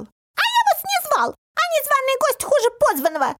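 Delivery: very high, piercing indignation; squeaky shout. A narrator's reading. Little man rebukes uninvited guests, shrill shouting. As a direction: shout it as a struggling little creature. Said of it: "вырывался и кричал".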